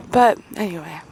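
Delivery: ordinary delivery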